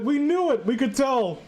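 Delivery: ordinary talk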